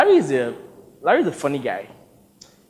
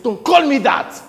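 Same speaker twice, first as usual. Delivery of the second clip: higher, louder, slower warning; firm don't; 8 semitones higher, 5 dB louder than usual. Retold or imitated. Victor warning Sonny off his full name, firm and raised. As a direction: lay down the line slowly and loud. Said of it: "I said".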